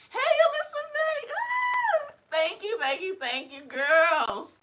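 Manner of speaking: high excited shout-out. Real, not acted. Excited shout to a fan, high and loud. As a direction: call out a name, thrilled.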